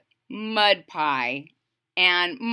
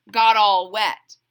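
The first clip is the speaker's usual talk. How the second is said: ordinary delivery, nothing marked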